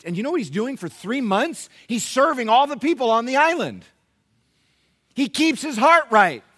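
full-force preaching. Real, not acted. Pastor telling the church how Paul served the island, full-force preaching. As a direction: drive it home to the congregation.

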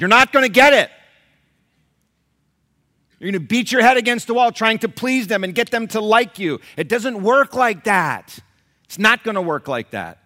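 high, very loud forceful rebuke; preacher's shout. Real, not acted. Preacher warning parents off pleasing their kids, shouting. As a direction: shout it at them from the pulpit.

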